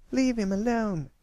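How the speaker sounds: put-on very high-pitched protective child's shout; shouting girl. A narrator's reading. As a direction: cry out in a high little-girl voice. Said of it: "Apple Bloom shouted".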